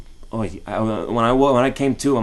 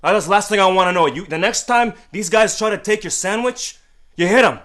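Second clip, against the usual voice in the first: higher, louder furious scolding; father yelling; 9 semitones higher, 6 dB louder than usual. Retold or imitated. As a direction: lay down the law at full volume. Father scolds crying son.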